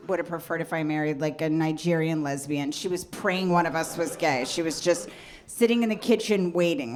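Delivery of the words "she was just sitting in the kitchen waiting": ordinary talk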